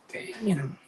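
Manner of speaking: breathy whispered Damn in disbelief. Retold or imitated. Muttering to himself at the casino, whispered. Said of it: "I'm like".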